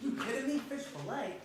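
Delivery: high incredulous "You kidding me?"; mock outrage. Confronting the patient about his fish fillet, in mock outrage. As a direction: throw up your hands in disbelief.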